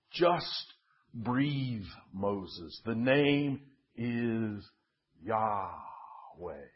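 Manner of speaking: low, soft and spacious, fading away; quiet, reverent voice of God; hushed divine voice. A narrator's reading. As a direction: breathe the words out gently, with long pauses. Divine voice of breath speaks to Moses.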